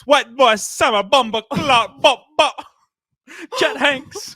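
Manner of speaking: a loud, high hammed-up Jamaican accent; mock Jamaican accent. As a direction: parody his put-on Jamaican accent. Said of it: "talks in a Jamaican accent all day and says".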